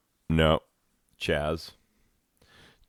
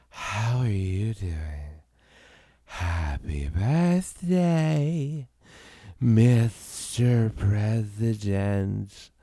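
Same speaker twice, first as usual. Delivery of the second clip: slow, breathy, higher sultry voice; breathy voice demo; 5 semitones higher, much slower than usual. Shown as a demonstration. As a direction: drag it out with lots of breath. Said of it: "So, like".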